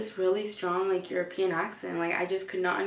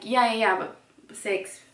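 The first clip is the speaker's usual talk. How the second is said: insistent; 3 semitones higher, 6 dB louder than usual. Retold or imitated. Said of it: "I'm like".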